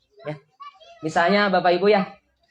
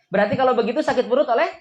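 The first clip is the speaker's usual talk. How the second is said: ordinary talk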